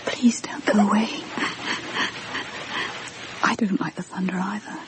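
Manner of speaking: hushed, voiceless pleading; frightened whisper. Acted. Frightened woman begging the ghost child to stay, whispering. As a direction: whisper so the ghost won't leave.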